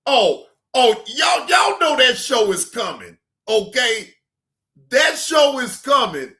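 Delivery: high, loud hype shout; excited shout. Real, not acted. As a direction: yell it out to the fans. Hyped shouting about the coming show.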